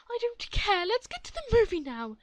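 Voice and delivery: high, loud brushing off; dismissive mom. Acted. Entitled mother brushing off the worker. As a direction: wave it away impatiently.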